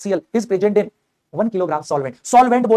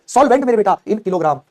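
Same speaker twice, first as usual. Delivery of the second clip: normal talking voice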